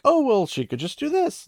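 imitating someone oversimplifying, high-voiced; imagined naive objection. Voices a reader's naive suggestion.

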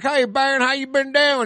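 put-on country guy accent. College kid greets his old friend, mock country accent. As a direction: imitate a country boy's hearty greeting. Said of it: "He starts talking like a country guy".

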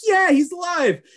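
high, loud happy cheer; mock relief cheer. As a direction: cheer that he's back. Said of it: "It's like".

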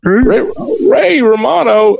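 high, slow comic voice; Ray Romano impression. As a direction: stretch the name out in a put-on voice. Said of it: "you just did Ray Romano".

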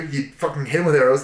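usual speaking voice

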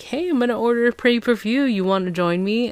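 lightly acted chirpy invite; imitated eager invitation. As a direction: mimic an upbeat guy asking someone along. Acting out sound guy's invitation.

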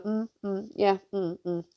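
half-hearted distracted mumbles; mumbling. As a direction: lightly mimic someone stuck in their phone. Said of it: "bare mumler".

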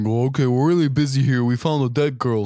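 lightly voiced brush-off; a hint of a harried official; busy police officer. Police brushing off a caller, lightly put-on voice. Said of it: "And she's like".

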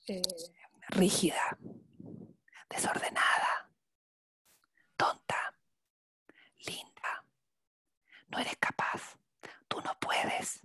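soft whisper close to the ear; right in your ear. Shown as a demonstration. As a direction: whisper each insult into their ear. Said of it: "escucha mi voz".

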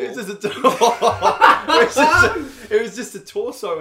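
high, loud, words collapsing into laughs; helpless laughter. Real, not acted. Teller repeats the shocking detail to co-host, laughing helplessly. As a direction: keep restarting as real laughter takes over. Cracking up at own story.

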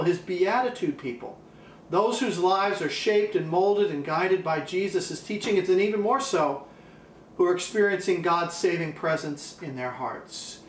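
ordinary delivery

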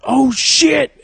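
high, loud mock alarm; retold shocked yell. Seeing the cat hit by a car, retold shocked yell. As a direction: blurt it out in exaggerated shock.

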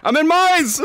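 high, urgent call-out; excited call-out. Real, not acted. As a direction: call it out eagerly. Calling out in game.

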